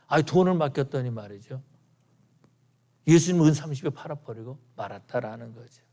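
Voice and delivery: ordinary talk, nothing marked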